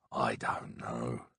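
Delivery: slow, breathy whisper of doubt; hushed, unsure whisper; whispered aside. Real, not acted. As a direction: drop to a slow whisper, almost to yourself. Doubtful aside about the killing.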